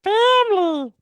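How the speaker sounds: very high, loud squeak of Family; a tiny Groot squeaking Family in Vin Diesel's place; Vin Diesel as Baby Groot. Retold or imitated. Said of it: "That was my Vin Diesel impression".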